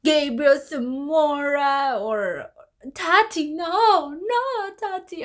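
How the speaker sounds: imitating a mocking friend rattling off names; mocking impression. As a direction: ham up a teasing gossip. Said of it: "how he would mock and be like".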